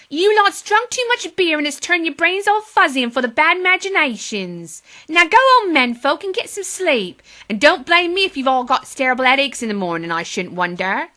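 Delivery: put-on high, loud bossy little girl; firm. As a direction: tell them off firmly. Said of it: "said Polly firmly".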